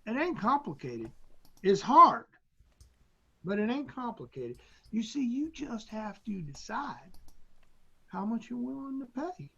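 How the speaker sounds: lightly put-on Southern accent of a drill instructor; Southern instructor accent, loosely imitated. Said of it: "talking in a deep Southern accent".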